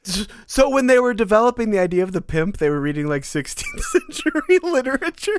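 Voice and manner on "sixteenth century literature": much higher, slower, breaking into a laugh mid-word; laughing question. Real, not acted. Amused at the sixteenth-century word origin, laughing through the question. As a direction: ask it while cracking up.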